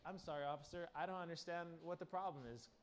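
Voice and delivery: hushed sheepish plea to an officer; meek apology. Retold or imitated. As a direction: play innocent and small. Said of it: "I'm just like".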